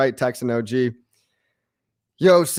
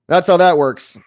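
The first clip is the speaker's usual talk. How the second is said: much louder and higher, hammering it home; emphatic; 5 semitones higher, 8 dB louder than usual. Real, not acted. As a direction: say it loud like a final verdict. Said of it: "repeats line, first take loud".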